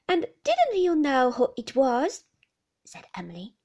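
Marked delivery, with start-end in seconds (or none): 0.1-2.2 put-on much higher, louder girlish voice; teasing girl. Emily teases David after he catches her, pert girlish voice.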